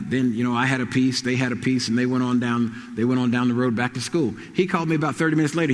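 ordinary talk

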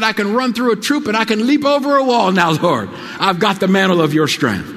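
high, loud victorious cry; triumphant declaration. Retold or imitated. Waking up, crying out to the Lord in triumph. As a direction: shout it like you just won. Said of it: "And I said".

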